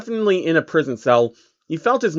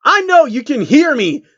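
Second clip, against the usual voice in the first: much higher, louder yell through the door; shouting; 11 semitones higher, 6 dB louder than usual. Acted. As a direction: shout at the prisoner to get through. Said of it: "Shining shouted".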